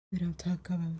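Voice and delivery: hushed secretive whisper. Pretend. Joking secret about the shop.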